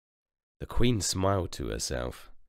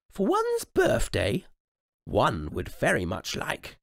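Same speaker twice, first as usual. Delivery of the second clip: high, slow regal announcement; slow high queenly declaration; queen announcing; 9 semitones higher, 3 dB louder, slower than usual. Acted. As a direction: announce it grandly to the table. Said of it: "announced the queen".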